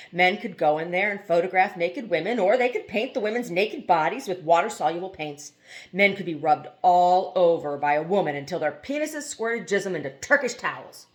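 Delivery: plain reading voice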